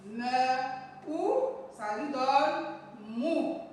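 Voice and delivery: slow blend of "Me" and "ou" into "mou"; syllable blending. Shown as a demonstration. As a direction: sound out "Me", "ou", then land on "mou". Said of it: "Nous allons lire nos syllabes formées". (assembler lentement « Me » et « ou » pour lire « mou »)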